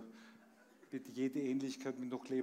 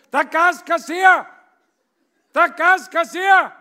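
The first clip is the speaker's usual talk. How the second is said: full-throated shout, much higher and louder; 27 dB louder, slower than usual. Acted. Gas collector yelling his title at the door, full shout. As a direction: bellow it at the closed door. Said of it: "schreit vor der Tür".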